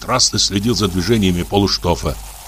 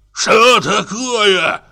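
put-on high, slow drunken bellow; drunk roar; 11 semitones higher, 4 dB louder, much slower than usual. A narrator's reading. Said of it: "заревел он". (пьяный рёв, высоко и медленно)

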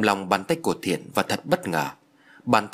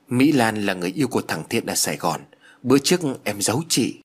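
ordinary delivery, nothing marked

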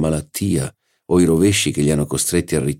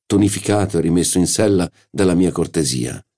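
ordinary delivery

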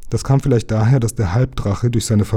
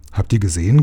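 plain reading voice

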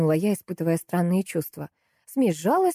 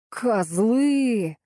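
drawn-out, higher angry cry; angry yell; 5 semitones higher than usual. A narrator's reading. Butcher curses his coworkers, yelling. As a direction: yell the insult with a long stretched vowel. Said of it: "заорал он".

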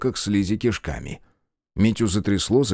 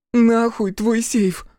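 much higher, slow, louder cry; grief-stricken scream; 13 semitones higher, 4 dB louder, much slower than usual. Acted. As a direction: scream it through tears.

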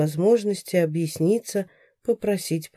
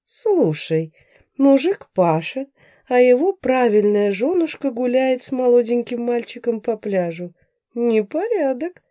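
gossipy neighbour; 6 semitones higher, 3 dB louder than usual. A narrator's reading.

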